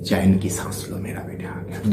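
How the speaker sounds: hushed film quote, fading to a whisper; Devasena impression. Host replaying a Baahubali line as a jibe, low and nearly whispered. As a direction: repeat the movie line very low, trailing into breath. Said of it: "Devasena बोला था ना".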